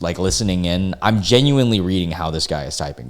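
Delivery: ordinary talk, nothing marked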